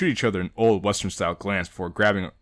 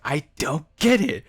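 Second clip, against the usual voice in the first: put-on slow, puzzled girl voice; Monika voice; 5 semitones higher, 5 dB louder, slower than usual. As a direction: play her baffled and slow. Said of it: "Monika's like".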